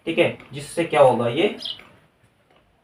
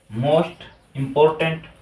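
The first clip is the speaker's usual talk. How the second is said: slow dictation; much slower than usual. Shown as a demonstration. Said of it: "यहाँ पे हम जोड़ देंगे most".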